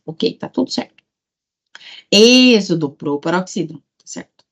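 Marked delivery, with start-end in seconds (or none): 2.1-2.9 ÊÊxodo, first syllable loud and held; calling the word. Shown as a demonstration. Teacher checking the accent, calling the word. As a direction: call out Êxodo, leaning hard on Ê.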